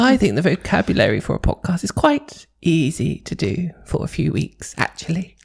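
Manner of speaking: a mock high pitched posh voice; squeaky high voice. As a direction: keep the silly high voice going. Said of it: "I could actually do the whole podcast like this".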